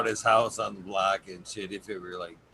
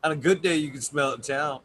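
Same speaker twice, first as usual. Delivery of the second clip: laughing; 4 semitones higher, 6 dB louder, faster than usual. Real, not acted. Laughing boast.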